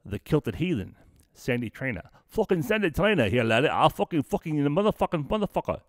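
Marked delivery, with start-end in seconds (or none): 2.3-5.8 higher, hammed-up Scottish brogue with laddie; lightly put-on Scottish accent, teasing; mock Scottish. Teasing the Kilted Heathen in a mock Scottish accent. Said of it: "He's, he's Scottish".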